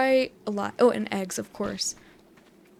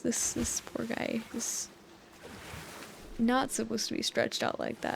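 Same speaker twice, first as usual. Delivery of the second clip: hushed aside; 7 dB quieter than usual. Real, not acted. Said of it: "Oh, yikes".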